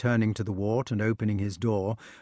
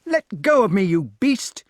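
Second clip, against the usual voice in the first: high, loud defiant cry; shouting boy; 9 semitones higher, 7 dB louder than usual. A narrator's reading. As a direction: yell it while struggling. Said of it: "he cried".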